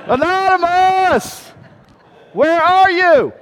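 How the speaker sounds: put-on high, drawn-out shout for a missing child; calling out. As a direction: yell it long and loud, searching. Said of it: "I called out".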